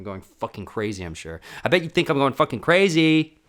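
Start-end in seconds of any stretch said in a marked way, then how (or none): 1.6-3.4 loud, high, manic yell; shouting. Real, not acted. To listeners who think I'm crazy, shouted. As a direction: yell it like you've lost it.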